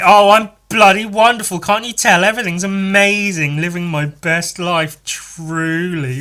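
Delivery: high, loud, gushing sarcasm; sarcastic. Acted. Cursed man answering the barista with loud high sarcasm. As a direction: gush with biting sarcasm. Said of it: "cursed to always being sarcastic".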